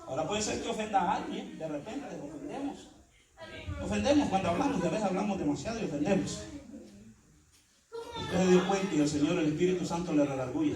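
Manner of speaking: normal talking voice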